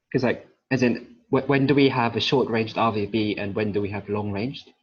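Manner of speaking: speaking up. Real, not acted. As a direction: speak up so they can hear you. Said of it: "could you speak up".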